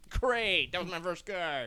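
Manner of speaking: high, loud mock dismay; mock exclamation. Telling friends about drawing the Fool, high mock exclamation. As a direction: cry it out in exaggerated reaction. Retelling her reaction to the Fool.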